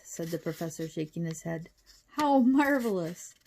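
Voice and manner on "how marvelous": slow, high, loud marvelling; delighted. A narrator's reading. Professor delighted at news of giant ants, marvelling. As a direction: savour MARVELOUS with falling wonder.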